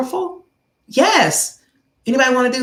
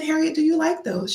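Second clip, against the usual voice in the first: coaxing question, higher voice; 7 semitones higher, 4 dB quieter than usual. Retold or imitated. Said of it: "I said".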